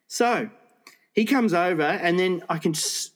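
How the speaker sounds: ordinary delivery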